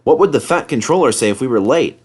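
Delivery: usual speaking voice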